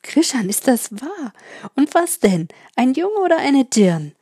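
high, loud, breathless questions; shouting. A narrator's reading. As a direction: call out in eager disbelief. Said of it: "schrie der Bauer".

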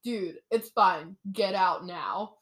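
mock calm dismissal; unimpressed prince. Retold prince dismissing guard.

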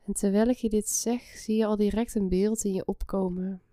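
soft spa whisper. Acted. Guides a relaxation meditation.

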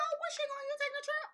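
high, sweet sarcastic questions; feigned naive surprise; mock innocent. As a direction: play dumb, voice way up high. Mom mocks son's suitcase.

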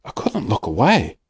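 ordinary delivery, nothing marked

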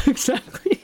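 high laughing exactly. Real, not acted. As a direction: laugh through the word.

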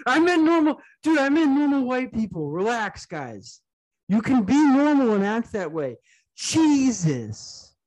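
loud, high-pitched mock outburst at the audience; exasperated mock shout. Podcaster pretends to correct offended listeners, exasperated mock shouting. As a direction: yell it in exaggerated exasperation, easing briefly on the aside.

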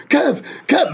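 high worried calling of a name; cousin calling out. Retold or imitated. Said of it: "He was like".

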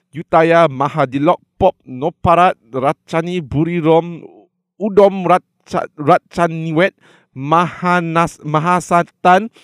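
slow, loud, careful reciting of the Thai name; careful Thai recitation. Real, not acted. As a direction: push through every syllable of Krung Thep. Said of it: "I'm gonna start again".

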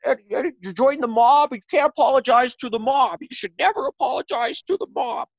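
high-pitched, scolding caricature; Jordan Peterson impression. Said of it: "is that your Jordan Peterson impression?".

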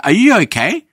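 high, loud mock concern. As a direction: squeak it out in disbelief.

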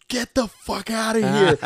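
high, loud, drawn-out disbelief; hammed-up outraged retort; indignant yell. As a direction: shout it high, comically caught out. Acted retort to being caught practicing.

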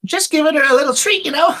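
high, sheepish excuse; innocent character voice. Acted. Player character answers the boy.

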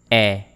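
E said as open "eh", as in bet; French open è. Shown as a demonstration. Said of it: "It is pronounced like this".

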